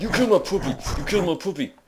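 high, loud mock wail of outrage; outraged cry. Player to whoever killed the game puppy, outraged cry. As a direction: cry out in over-the-top anguish.

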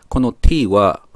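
usual speaking voice

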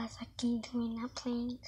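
hushed whisper to camera; whisper, giving up the game; whispering while hiding. Real, not acted. Hiding in the closet.

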